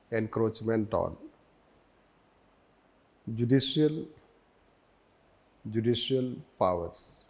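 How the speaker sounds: measured note-dictation with long pauses. Real, not acted. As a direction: dictate phrase by phrase, leaving time to write. Dictates notes, repeating each phrase.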